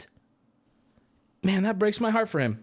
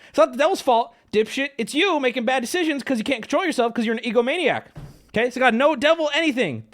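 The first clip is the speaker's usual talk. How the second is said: raised, heated scolding; angry rant; 8 semitones higher than usual. Real, not acted. Ranting at Will Smith.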